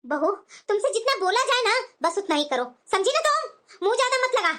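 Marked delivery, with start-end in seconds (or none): 2.9-4.5 high-pitched, cross telling-off; stern warning. Acted. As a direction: shut down her back talk. Mother-in-law silences back talk. (सख़्त चेतावनी देते हुए)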